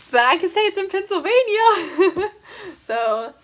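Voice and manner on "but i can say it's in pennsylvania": laughing. Real, not acted. Teasing college reveal.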